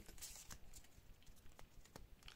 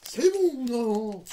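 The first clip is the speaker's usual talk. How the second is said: excited squeal; 11 semitones higher, 5 dB louder, much slower than usual. Real, not acted. Squeals at the pull.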